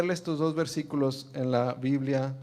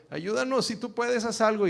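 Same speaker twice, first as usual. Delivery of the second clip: higher, pleading father's cry; desperate father; 8 semitones higher than usual. Acted. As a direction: beg like a frantic father. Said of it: "el padre le dice". (padre desesperado suplicando, en voz más aguda)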